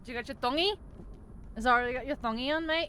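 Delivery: hammed-up Australian accent; mock Australian. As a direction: tease like a mock Aussie mate. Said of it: "Victoria's Australian".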